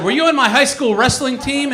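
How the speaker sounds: incredulous attacker. Retold or imitated. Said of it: "And he said".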